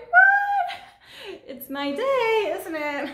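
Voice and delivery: a high, loud, amazed 'What?', then a laughing 'my day'; overjoyed shock at sudden luck; retold disbelief. Retold surprise at getting dope.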